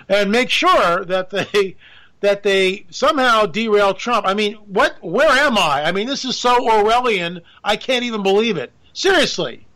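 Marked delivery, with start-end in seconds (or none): none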